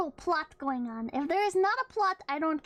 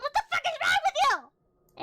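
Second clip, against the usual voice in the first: high, loud mock outburst; a sudden, high, over-the-top mock yell at the viewer; mock outrage; 11 semitones higher, 4 dB louder than usual. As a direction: snap at the viewer in fake outrage.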